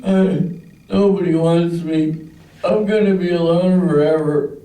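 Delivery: high, whiny, hammed-up drunk sobbing; mock drunk crying. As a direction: wail self-pity like a weepy drunk. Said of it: "and I'm crying".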